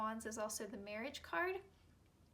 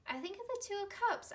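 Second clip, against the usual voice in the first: higher and faster, bursting with enthusiasm; excited emphasis; 10 semitones higher, 3 dB louder, faster than usual. Real, not acted. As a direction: blurt it out eagerly. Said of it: "I, I do".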